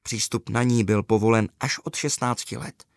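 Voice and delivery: plain reading voice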